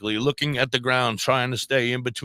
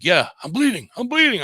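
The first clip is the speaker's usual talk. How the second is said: high, louder, frightened insisting; the hurt boy won't be calmed; insistent panic; 8 semitones higher, 4 dB louder than usual. Retold or imitated. Injured teen insisting to the driver, high and panicked. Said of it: "he's like".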